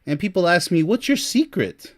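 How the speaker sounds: ordinary talk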